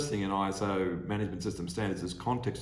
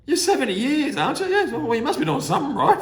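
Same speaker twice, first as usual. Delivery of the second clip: high laughing quote; 8 dB louder than usual. Retold or imitated. Retold chat with old lady.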